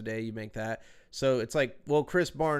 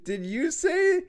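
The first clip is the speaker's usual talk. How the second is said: higher, louder, amused disbelief; incredulous; 5 semitones higher, 7 dB louder, slower than usual. Real, not acted. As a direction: ask it laughing in disbelief. Teasing, laughing incredulous question.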